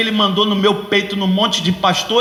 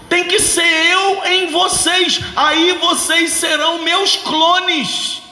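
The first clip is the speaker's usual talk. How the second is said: higher, slow and deliberate; slow emphatic Jesus voice; 6 semitones higher, slower than usual. Retold or imitated. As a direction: spell it out slowly like Jesus. Voicing Jesus, slow and high.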